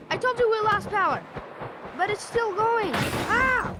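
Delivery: high, loud shouting in mock panic; panicked play-acting. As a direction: yell it as the craft fails. Acted flight losing power.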